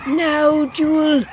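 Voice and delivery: high, loud, voiced urging; urgent stage whisper. A narrator's reading. Mother prompting her daughter in battle, urgent. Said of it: "her mother whispered".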